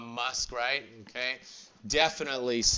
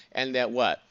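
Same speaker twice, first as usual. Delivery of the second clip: normal talking voice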